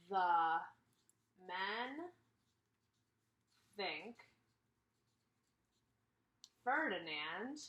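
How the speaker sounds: word by word, very slow and spaced out; slow dictation. Real, not acted. Teacher says each word slowly while writing the Venn diagram. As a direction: spell it out slowly as you write.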